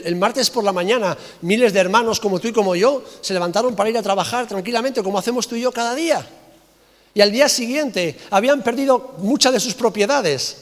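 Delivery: ordinary talk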